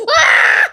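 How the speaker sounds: loud laughing yell; gleeful shout. Real, not acted. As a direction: burst out in a triumphant scream. Child finishes counting, yells.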